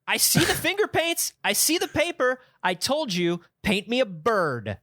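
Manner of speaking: put-on stern boss scolding a child; barking newspaper editor. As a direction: bark orders at a kid. Boss scolding four-year-old child.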